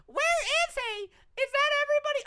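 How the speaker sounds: squeaky high calling voice; high-pitched flight attendant. Retold or imitated. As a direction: pipe it up high like a frantic attendant. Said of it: "them inside being like".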